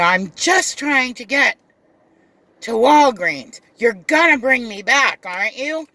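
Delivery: the passenger insisting on her Walgreens trip; demanding customer. Retold or imitated. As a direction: push the driver as the entitled customer. Customer pleads about Walgreens.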